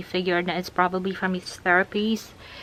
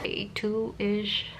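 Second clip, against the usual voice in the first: ordinary talk, nothing marked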